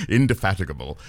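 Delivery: indefatigable in a crisp London accent; Indefatigable, said in a London accent on request. Shown as a demonstration. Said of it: "Could you say indefatigable again in that London accent?".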